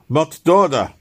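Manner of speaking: loud, high, curt demand from a stranger; gruff Russian guard. Acted. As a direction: bark the password question at the newcomer. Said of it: "repeated the Russian".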